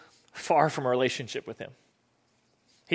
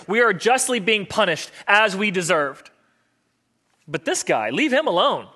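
high, louder incredulous scolding; comic exasperation; 5 semitones higher, 4 dB louder than usual. Acted. Thief defends Jesus to the other thief, comically exasperated. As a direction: scold in swooping, exaggerated pitch. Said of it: "comedic moment almost".

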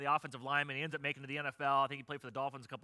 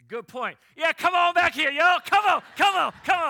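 mock-brave shout, much higher and louder; taunting shout; 13 semitones higher, 16 dB louder than usual. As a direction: call them back, full of borrowed bravado. Taunting the golfers to come back.

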